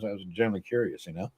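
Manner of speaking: normal talking voice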